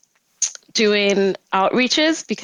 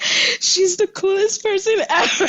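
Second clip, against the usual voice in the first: starstruck gush; 12 semitones higher than usual. Retold or imitated. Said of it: "I was just like".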